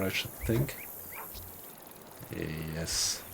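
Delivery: ordinary delivery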